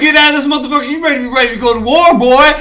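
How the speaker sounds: rally them hard for battle; hyped war rally. Acted. Playing someone pushing a soldier to get ready for war, hyped up.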